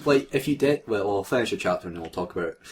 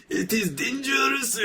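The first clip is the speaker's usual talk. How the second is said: mock menacing line. Reading villain line in mock voice.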